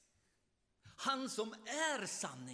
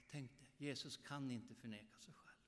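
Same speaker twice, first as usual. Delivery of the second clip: ordinary talk, nothing marked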